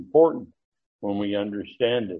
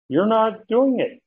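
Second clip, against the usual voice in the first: accusing; 9 semitones higher than usual. Retold or imitated. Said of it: "So he's saying".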